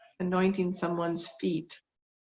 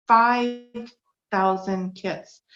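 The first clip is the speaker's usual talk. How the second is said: five thousand kits stretched out slowly, each word weighed; slow emphatic figure; 3 semitones higher, 4 dB louder, much slower than usual. Real, not acted. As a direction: let "five" land heavy and slow.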